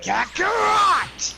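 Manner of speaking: slow, high roaring brute shout; Broly voice. Acted. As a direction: roar the name, drawn out, like a raging brute. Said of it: "my Broly voice is basically".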